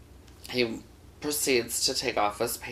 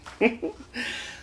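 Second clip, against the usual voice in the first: laughing; 10 semitones higher than usual. Real, not acted. Own laugh.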